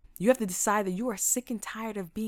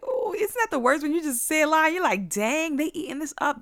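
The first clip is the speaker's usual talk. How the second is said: high, loud cry of guilty dismay; mock dismay; 10 semitones higher, 6 dB louder than usual. Student whose lie was believed, loud mock dismay. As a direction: wail it up high, playfully mortified.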